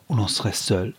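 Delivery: ordinary delivery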